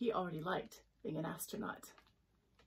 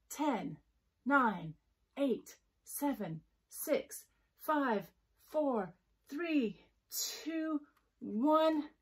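building countdown; 6 semitones higher, much slower than usual. A narrator's reading. Countdown to launch.

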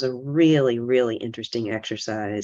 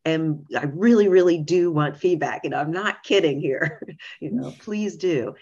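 earnest plea, raised pitch and lifted energy; emphatic retold appeal, higher than usual; very serious, insistent; 5 semitones higher, 3 dB louder than usual. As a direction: press the point sincerely, with a small laugh near the end. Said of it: "I was very serious to say".

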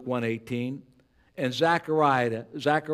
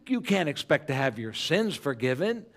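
ordinary talk

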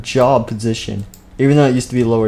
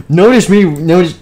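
high, loud call to be noticed; loud demand for attention; 6 semitones higher, 7 dB louder than usual. Acted. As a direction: yell to make them look at you. Teacher demands child's attention.

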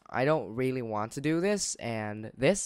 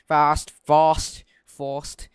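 fast said as fɑst in a mock British accent; 6 dB louder, much slower than usual. Gamer jokingly saying fast in a British accent. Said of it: "wow, British accent".

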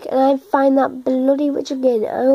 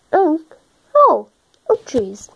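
ordinary delivery

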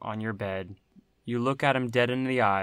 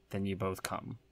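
ordinary talk, nothing marked

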